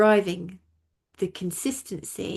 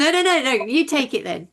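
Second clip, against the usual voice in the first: excited; 6 semitones higher, 6 dB louder than usual. Real, not acted. Hands the point back, laughing.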